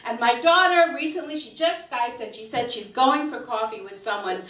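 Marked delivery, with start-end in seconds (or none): none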